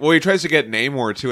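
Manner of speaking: usual speaking voice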